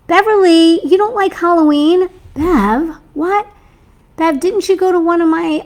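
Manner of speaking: high, loud call to a friend; playful calling out. Real, not acted. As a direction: call her name across the room. Calling out to a viewer.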